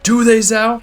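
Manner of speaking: firm, challenging question; with conviction. Retold or imitated. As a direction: push back with conviction. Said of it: "Oz says this with conviction".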